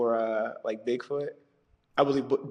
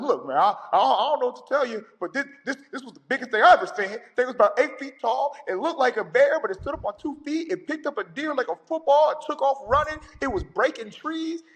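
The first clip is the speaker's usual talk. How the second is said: much higher, fast in places, with nervous laughs; shaken witness voice; 10 semitones higher than usual. Retold or imitated. Husband on the phone telling the operator what he saw, high nervous voice. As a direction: rattle it off like someone still spooked.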